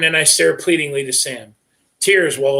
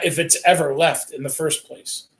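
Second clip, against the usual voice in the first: plain reading voice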